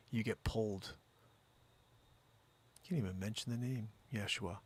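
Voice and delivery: hushed mock-spiritual correction; quiet solemn preaching. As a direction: lean in and preach it softly. Soft solemn preacher lecture.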